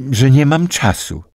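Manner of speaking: a touch of despair. A narrator's reading. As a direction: plead that there's no time. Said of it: "w jej głosie usłyszał rozpacz".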